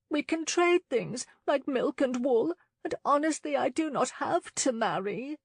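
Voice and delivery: lightly voiced lady, understated unhappiness; miserably. A narrator's reading. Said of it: "she said miserably".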